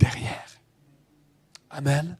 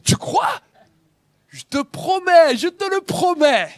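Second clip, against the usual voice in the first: much higher, louder disbelief and promise; high loud storyteller voices; incredulous exchange; 13 semitones higher, 5 dB louder than usual. Acted. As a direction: act out the prophet and angel bargaining. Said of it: "il dit".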